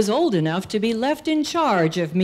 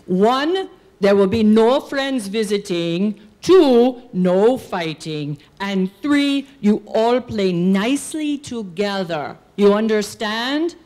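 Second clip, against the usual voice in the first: put-on father laying down rules in a Dutch accent; firm, punched counting in a thick Dutch accent; 3 dB louder than usual. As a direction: lay down the law like a Dutch father. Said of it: "in his thick Dutch accent".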